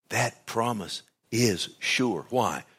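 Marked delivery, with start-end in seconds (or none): none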